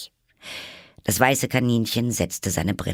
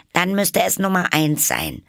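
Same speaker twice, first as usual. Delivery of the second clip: triumphant retort; 12 semitones higher, 4 dB louder than usual. Acted. Alice retorts cleverly.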